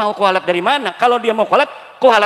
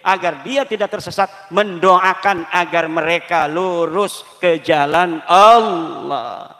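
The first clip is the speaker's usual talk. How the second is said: normal talking voice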